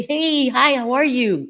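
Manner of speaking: high, sing-out hello; cheery greeting. Acted. Acting a friendly passing greeting.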